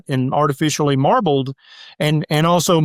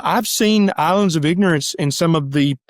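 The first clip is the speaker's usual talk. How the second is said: normal talking voice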